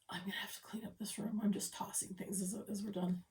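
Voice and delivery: fast unvoiced whisper to herself; a quick hushed aside. Real, not acted. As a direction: mutter it quickly under your breath. Whispered aside while stitching.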